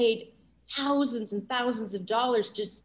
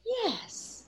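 high, soft acted yes; charmed reply; 13 semitones higher, 4 dB quieter than usual. Driver replies to the soothing car voice, charmed. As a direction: answer the car voice high and softly. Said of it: "I find myself saying".

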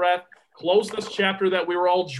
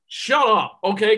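loud, higher snap at the doubters; exasperated outburst, pitched up; annoyed shout; 4 semitones higher, 6 dB louder than usual. Real, not acted. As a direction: tell them to shut up. Snapping at imagined critics.

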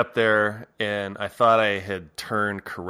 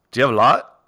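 imitating Australian; 4 semitones higher, 10 dB louder, much faster than usual. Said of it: "was Australian, and he goes".

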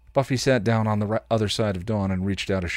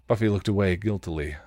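ordinary delivery

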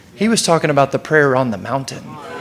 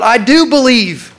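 high, slow, raised-voice declaration; crying out; 5 semitones higher, 3 dB louder, slower than usual. A narrator's reading. Boy's father answering Jesus, crying out. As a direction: cry it out with conviction, drawn out. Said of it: "The father instantly cried out".

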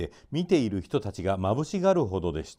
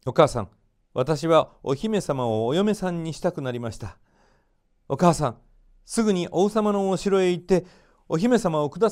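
higher and louder, begging his mother eagerly; eager pleading; 7 semitones higher, 4 dB louder than usual. Acted. Aladdin begging his mother to ask for the princess, eagerly pleading. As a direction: plead with rising excitement. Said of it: "と言ってせがみました".

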